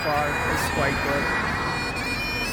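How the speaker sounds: distorted, crackly recording; fuzzy distorted mic audio; fuzz distortion. Real, not acted. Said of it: "Sorry for the distortion".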